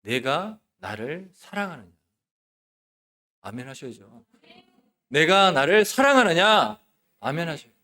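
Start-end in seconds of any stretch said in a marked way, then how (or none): 0.0-1.9 slow, soft, solemn question; speaking as Jesus. Acted. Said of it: "예수님이 말씀하시는 것처럼 들으시기 바랍니다". 5.1-6.8 loud, higher, slow proclamation; speaking as Jesus. Acted. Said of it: "예수님이 말씀하시는 것처럼 들으시기 바랍니다".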